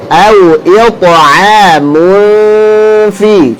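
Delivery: "mulfi" with a long light hum before fi; correct held nasal. Shown as a demonstration. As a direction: draw out the hum in "mulfi", then a thin fi. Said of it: "Yang betul".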